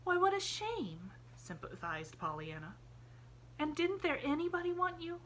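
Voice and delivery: put-on a sympathetic child rattling on; eager little girl. A narrator's reading.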